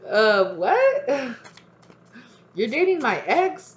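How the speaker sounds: high, loud, laughing disbelief; incredulous outrage. Acted. Acted confrontation over dating ex.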